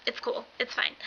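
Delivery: soft, whispery self-reassurance; retold playing it cool under the breath; feigned composure. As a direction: murmur it to keep yourself in check. Talking herself down in line.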